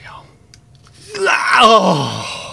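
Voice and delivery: drawn-out high build to a loud cry; excited shout. Real, not acted. Opener shouting as a big card is revealed. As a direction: erupt when the card shows.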